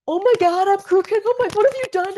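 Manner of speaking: high panicky mock scream; hammed-up fright at the chiropractor; retold panicked cry. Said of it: "so I'm screaming".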